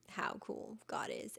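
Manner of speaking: hushed and slow, like sharing a wonder; awed whisper. Real, not acted. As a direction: whisper "how cool God is" slowly, in awe. Awed aside about God.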